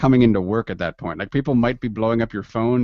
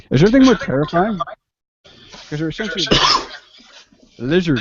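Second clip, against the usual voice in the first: excited; 8 semitones higher, 4 dB louder, faster than usual. Pretend. Mock horror at alligators.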